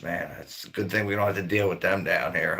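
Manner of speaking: ordinary talk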